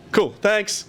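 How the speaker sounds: high, over-bright thanks; mock-cheerful acceptance. Job-seeker thanking the crew who refused him, mock-cheerful. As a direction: put on cheer after a rejection. Brave face after being turned down.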